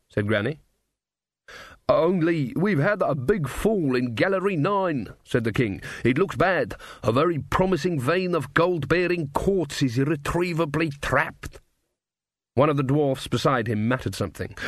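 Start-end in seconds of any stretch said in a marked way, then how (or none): 1.9-5.1 slow, higher-pitched character voice; drawn-out report from a dwarf king in trouble; troubled dwarf king. Acted. 6.0-11.6 higher-pitched dwarf character voice; troubled dwarf king. Acted.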